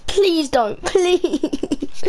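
high pleading, breaking into laughter; laughing plea. Real, not acted. Begging viewers not to like the video, laughing. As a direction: beg through a laugh.

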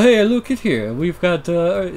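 put-on loose New York accent, high; a touch of New York tough guy. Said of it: "Now you can do a New York accent".

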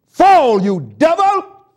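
loud, high command to the devil; shouted rebuke. Real, not acted. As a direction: cast him down with the voice.

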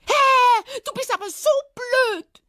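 very high, squealing hare tantrum; angry scolding. Acted. Hare scolding after the departing fox, angry. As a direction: yell it after him, high and furious. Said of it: "schimpfte er ihm wütend hinterher".

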